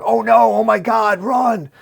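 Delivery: high, loud terrified shrieks; panicked scream. A narrator's reading. Gardeners fleeing the falling antenna, high panicked screaming. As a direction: scream in terror as it falls. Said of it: "as they scream".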